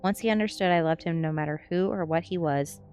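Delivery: ordinary delivery, nothing marked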